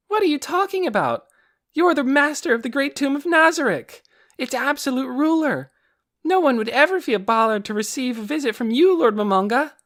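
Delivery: put-on high, loud young girl voice; eager girl voice. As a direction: protest brightly like a child. Aura answering her lord eagerly.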